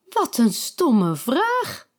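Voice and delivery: slow, haughty scoff; scornful princess. A narrator's reading. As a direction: drag it out, offended. Ariana scoffs.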